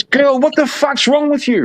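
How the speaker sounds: high, incredulous yell at his wife; exasperated old man. Retold or imitated. Husband home, furious at his wife's signing, high exasperated yell. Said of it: "the old man comes home and goes".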